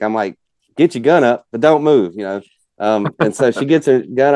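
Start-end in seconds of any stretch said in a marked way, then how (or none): 0.8-2.1 raised, higher, louder retold command; frantic yell. Father telling his kid to get ready to shoot, frantic yell. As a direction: replay your own urgent orders. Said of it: "and I'm frantic".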